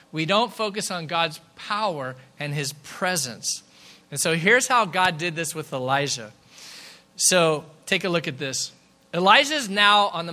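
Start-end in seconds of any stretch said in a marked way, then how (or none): none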